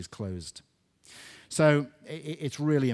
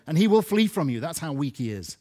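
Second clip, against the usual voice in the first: louder, pressing, ringing out; loud proclamation; 4 semitones higher, 8 dB louder than usual. Acted. Preacher urging the church to resist the devil, forceful. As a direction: drive the verse home.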